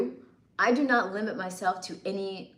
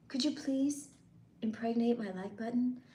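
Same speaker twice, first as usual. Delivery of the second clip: softer, higher coaxing request; coy plea; 3 semitones higher, 5 dB quieter than usual. Acted. Streamer coaxing viewers to press like, coy plea. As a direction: ask it coyly, pitched up and quieter.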